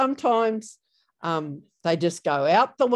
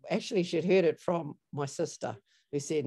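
normal talking voice

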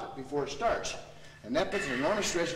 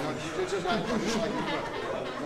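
laughing; 8 semitones higher, 3 dB louder, slower than usual. Real, not acted. Laughing aside to student.

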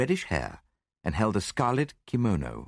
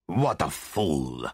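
low, slow angry growl; big Italian's drawn-out, understated roar; 5 semitones lower, much slower than usual. A narrator's reading. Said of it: "roared the big Italian".

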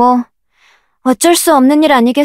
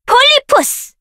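much higher, ringing out; high shouted summon; 14 semitones higher than usual. Acted. Summoner shouting the creature's name high.

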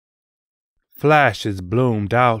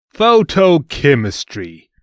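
loud raised spell call; spell shout; 4 semitones higher, 6 dB louder than usual. Acted. Otis casting a spell.

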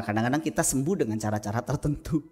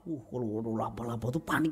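ordinary delivery

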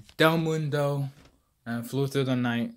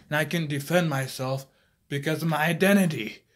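ordinary delivery

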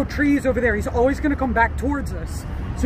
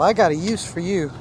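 teasing pet voice; 7 semitones lower, 5 dB quieter than usual. Pretend. Talking to the caught iguana.

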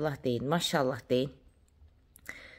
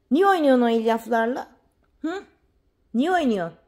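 high-pitched playful telling-off; mock scolding; 6 semitones higher than usual. Scolding a pet for bringing fibre, high mock threat.

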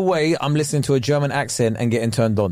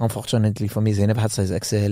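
low, put-on French accent storytelling; mock French accent; 4 semitones lower, faster than usual. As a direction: tell it as a hammed-up Frenchman. Joking on in fake French.

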